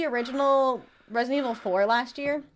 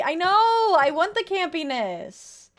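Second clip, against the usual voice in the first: excited; 7 semitones higher, 6 dB louder, much slower than usual. Real, not acted. Passionate about campy games.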